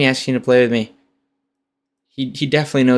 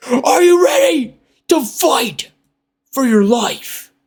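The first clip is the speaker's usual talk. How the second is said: high, slow announcer yell; hype shout; 11 semitones higher, slower than usual. Shown as a demonstration. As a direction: bellow it like a ring announcer.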